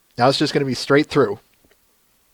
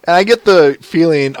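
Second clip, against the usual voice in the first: normal talking voice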